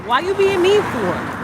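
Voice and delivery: mock scolding. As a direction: tease the dog in a high voice. High scolding voice at dog.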